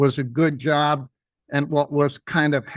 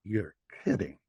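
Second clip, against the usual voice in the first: much lower and softer, disbelieving; stunned boss; 10 semitones lower, 11 dB quieter than usual. Retold or imitated. The boss hearing all the flowers sold in the rain, low stunned voice. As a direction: drop low and quiet in disbelief.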